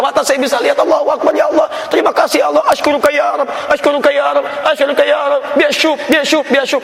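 high, overjoyed cries of thanks; ecstatic thanks. Retold or imitated. Healed man thanking God at the Kaaba, ecstatic. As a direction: pour out thanks in rapture. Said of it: "Dia teriak".

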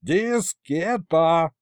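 high, very drawn-out shout; long insistent yell. A narrator's reading. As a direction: holler it syllable by syllable as a threat. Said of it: "заорал и я".

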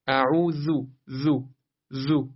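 زو with a bee-like buzz, tongue tip at upper teeth; buzzing dhal. Shown as a demonstration. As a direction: let the ذ of اعوذ buzz. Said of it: "همراه صدای زنبوری". (ذال در اعوذ، زو با صدای زنبوری)

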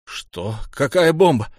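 loud, high startled question; alarmed disbelief. A narrator's reading. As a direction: shout back in shock. Shocked reply to bomb warning.

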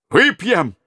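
high, loud cheer; cheering toast. Acted. Robbers to the prince, high loud cheer. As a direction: raise the cup and shout.

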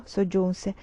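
ordinary delivery, nothing marked

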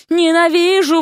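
high, drawn-out hateful cry; wailing yell. A narrator's reading. Vasya jumping on her husband, yelling hatred. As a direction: scream it in a tantrum. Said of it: "вопила она".

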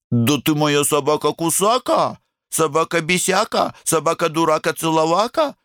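высокое ласковое сюсюканье; сюсюкай, как с малышом; сюсюканье с собакой. A narrator's reading. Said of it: "нежно погладила". (ласковое сюсюканье с собакой)

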